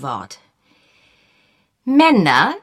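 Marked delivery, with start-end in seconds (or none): 1.8-2.5 put-on a prim old maid holding forth, raised and firm; lightly voiced prim spinster lecturing. A narrator's reading. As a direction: lecture like a proper elderly spinster. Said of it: "dozierte sie im Ton einer alten Jungfer".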